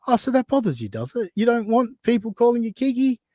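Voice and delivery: high teasing mock sympathy; sarcastic taunt. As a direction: needle them, pitch up.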